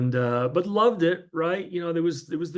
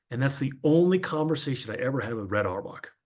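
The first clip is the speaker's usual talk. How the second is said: normal talking voice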